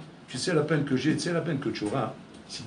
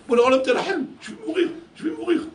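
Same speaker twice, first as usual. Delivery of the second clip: much higher, shouting, laughing with rapture; ecstatic shout; 12 semitones higher, 5 dB louder than usual. Retold or imitated. David shouting his love for God, high and ecstatic. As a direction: cry out, overwhelmed with love. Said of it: "il criait".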